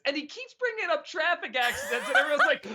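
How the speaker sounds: high voice breaking into laughter; laughing while talking. Real, not acted. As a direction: crack up while telling the story. Cracking up retelling a film scene.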